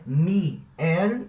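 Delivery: Mi, el split apart as two syllables. Shown as a demonstration. Teacher showing the wrong split of miel. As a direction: break Mi and el apart. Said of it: "o ímpeto de separar".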